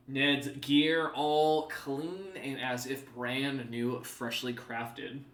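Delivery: usual speaking voice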